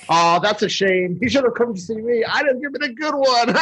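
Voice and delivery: high mock sympathy building to glee; Snow Miser. Snow Miser joking about the husband's cold, high gleeful voice. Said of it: "Snow Miser's talking to Missus Claus".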